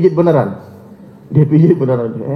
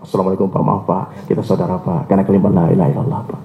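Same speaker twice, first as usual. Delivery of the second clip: humble soft greeting; 9 semitones lower, 3 dB quieter than usual. Retold or imitated. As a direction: lower and softer, politely humble. Humble greeting to suspicious householder.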